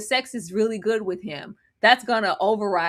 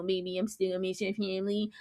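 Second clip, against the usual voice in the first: very soft imitated mumble; hushed mock reassurance; mock whispering; 2 semitones lower, 11 dB quieter than usual. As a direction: murmur it as the pleading boyfriend. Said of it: "whispering in my goddamn camera".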